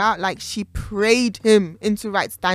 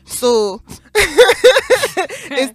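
laughing; 9 semitones higher, 8 dB louder than usual. Real, not acted. Laughing verdict.

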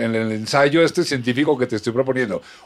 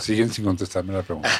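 ordinary talk, nothing marked

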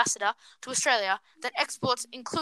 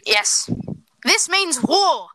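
dramatic headline read; 5 semitones higher, 5 dB louder, much slower than usual. Real, not acted.

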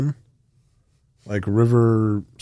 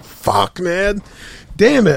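frustrated outburst; 9 semitones higher, 4 dB louder, slower than usual. Retold or imitated. Frustrated after dying.

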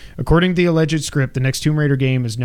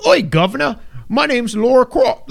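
put-on terrible Cockney accent; 10 semitones higher, 3 dB louder than usual. Mocking the host's script reading in awful Cockney. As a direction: overdo a bad Cockney accent like a joke reading. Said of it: "the worst accent ever".

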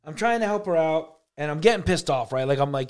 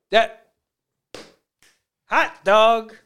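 raised, louder exclamation at the picture; excited; 6 semitones higher, 8 dB louder, much slower than usual. Real, not acted. Reacting to a strange picture, high and loud. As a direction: burst out at the weird image.

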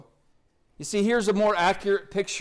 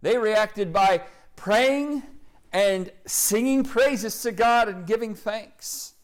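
slow emphasis; 4 semitones higher, slower than usual. Acted. Emphatic slow preaching line.

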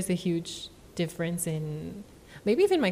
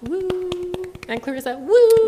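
cheering; 12 semitones higher, 9 dB louder than usual. Real, not acted. Friend cheering after the call to clap for Chris, whooping. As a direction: cheer for a friend's good news.

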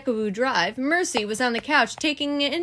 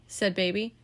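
ordinary delivery

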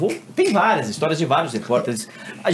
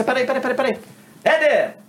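mock panicked call; 11 semitones higher than usual. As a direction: shout for the anchor in a panic. Reporter calling for help.

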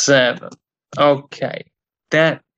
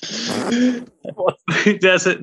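ordinary talk, nothing marked

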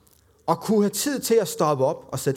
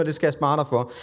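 ordinary talk

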